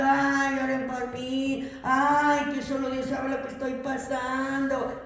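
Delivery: imitating a weepy preacher, drawn-out ays; mock a preacher crying from the pulpit; mock tearful preacher. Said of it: "predicadores llorando".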